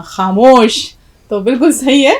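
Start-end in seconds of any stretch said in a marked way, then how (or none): 0.0-0.9 drawn-out, louder film dialogue catchphrase; Shatrughan Sinha. Retold or imitated. Teacher quoting a film star's dialogue, slow emphatic impression. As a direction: stretch it out like a famous movie command. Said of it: "Shatrughan Sinha کو بولتے سنا ہوگا".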